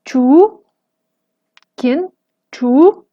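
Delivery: chu rising sharply with a glottal catch; rising-tone chu, glottalized. Shown as a demonstration. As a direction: sweep chu upward, then choke it. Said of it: "Por ejemplo".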